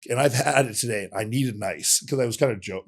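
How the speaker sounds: normal talking voice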